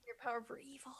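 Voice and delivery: whisper. Pretend. Whispered joke.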